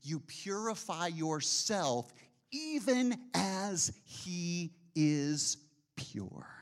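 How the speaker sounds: slow, reverent reading of the verse; scripture reading. Acted. Preacher reads scripture slowly to the church. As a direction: read the verse slowly and solemnly. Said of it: "It says".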